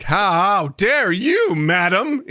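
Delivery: slow, high theatrical indignation; hammed-up shocked "how dare you, madam"; mock outrage. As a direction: drag it out like an offended aristocrat.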